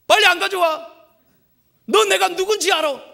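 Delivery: loud, high indignant yell; furious shout. Retold or imitated. Pastor imitating a customer shouting at the waiter, furious. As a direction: scream it like an entitled boss.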